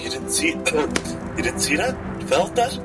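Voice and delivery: usual speaking voice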